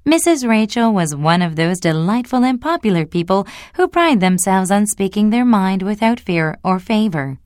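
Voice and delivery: ordinary delivery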